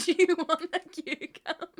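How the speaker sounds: giggling through the question; laughing. Real, not acted. Streamer laughing reading chat, laughing. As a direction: laugh while asking it.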